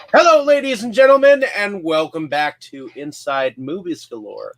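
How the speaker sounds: big, high, fast announcer welcome; show host greeting. Acted. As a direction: throw it out like a TV host opening the show.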